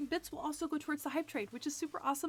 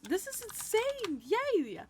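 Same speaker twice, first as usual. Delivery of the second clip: shrieking jackpot winner; 7 semitones higher than usual. Real, not acted. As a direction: squeal like you just won. Hype train starts.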